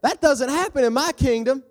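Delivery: high, loud enraged rant; furious king. Acted. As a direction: roar out threats like an outraged ruler. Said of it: "He's getting mad. He's like".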